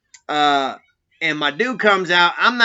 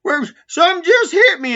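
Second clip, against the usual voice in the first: high, loud startled yelp of a question; startled complaint; 8 dB louder than usual. Retold or imitated. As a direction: squeal it like something just smacked you. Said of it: "guy reacting to being hit".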